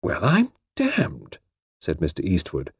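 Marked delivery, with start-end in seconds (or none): 0.0-1.4 slow, amazed exclamation; hushed disbelief; astonished. Acted. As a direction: marvel at what just happened. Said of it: "very much astonished".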